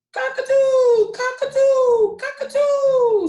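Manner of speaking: high, loud screeching alarm. Acted. Friends warn the dizzy cockatoo, high alarmed screech. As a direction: shriek the warning at the dizzy bird. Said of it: "screeched his friends in alarm".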